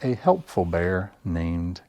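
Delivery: usual speaking voice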